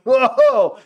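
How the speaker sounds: loud high whoa of shock; astonished. Real, not acted. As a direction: let it burst out in disbelief. Stunned by defiant kid video.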